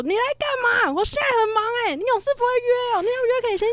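imitating an angry roar, very high-pitched; furious roar. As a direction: rant at someone who turned up uninvited, high strained yell. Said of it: "他就狂吼说".

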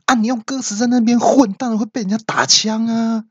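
shouted high-pitched scolding; high exasperated yell. Retold or imitated. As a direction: yell it up high, fed up with his nonsense. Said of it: "我就大叫说".

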